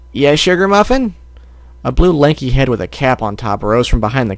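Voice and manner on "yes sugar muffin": high sweet husband voice. Acted. Mr Cake reply.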